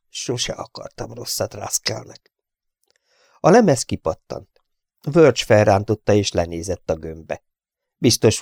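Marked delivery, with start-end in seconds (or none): 0.1-2.3 much softer, guilty murmur; hushed guilt. A narrator's reading. Engineer praying softly about the gunner, hushed. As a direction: confess it quietly. Continues silent prayer.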